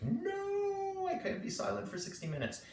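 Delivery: hammed-up panicky protest, starting very high; mock freak-out. Meditation teacher imitating students dreading an hour of silence, mock freak-out. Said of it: "a moment of, of freak out".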